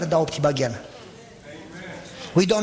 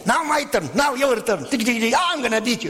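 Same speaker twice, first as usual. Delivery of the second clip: put-on kids bickering; 5 semitones higher than usual. Kids playing video games.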